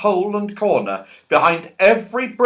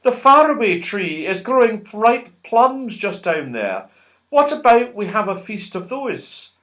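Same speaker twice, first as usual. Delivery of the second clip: a touch higher, gentle fairy voice; lightly voiced Silky proposing a plum feast; 5 semitones higher than usual. A narrator's reading. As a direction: suggest it sweetly. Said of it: "called Silky".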